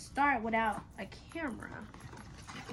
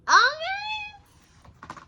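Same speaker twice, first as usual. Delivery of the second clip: high excited squeal; 10 dB louder than usual. Real, not acted. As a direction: squeal it out, thrilled. Squeals at camera.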